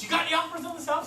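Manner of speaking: quick, high-pitched phone query; listing agent. Retold or imitated. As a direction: rattle off the question brightly high. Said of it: "the listing agent goes".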